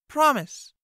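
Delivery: put-on girl commanding the knight to promise. A narrator's reading. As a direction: order him to swear. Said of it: "Fee commanded".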